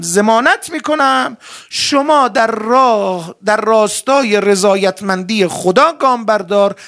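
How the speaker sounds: loud, drawn-out proclamation to the congregation; impassioned preaching. Acted. Preacher voicing the Imam's guarantee to believers, impassioned and loud. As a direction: preach it at full force, stretching the key words.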